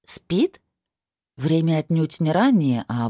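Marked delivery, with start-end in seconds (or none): 0.1-0.6 adult surprised echo, Спит? asked with a rise. Acted.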